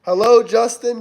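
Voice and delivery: normal talking voice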